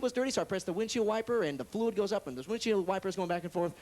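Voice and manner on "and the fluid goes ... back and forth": normal talking voice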